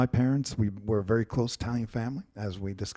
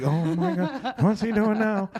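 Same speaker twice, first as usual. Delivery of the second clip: mocking parents; 5 semitones higher, slower than usual. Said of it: "They're like".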